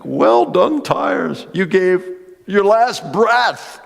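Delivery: high, loud, stretched praise; preacher joyfully praising his worn tires, high and loud. Retold or imitated. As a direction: celebrate the tires, dragging out last breath. Laughing with God, praising tires.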